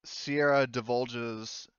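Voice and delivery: normal talking voice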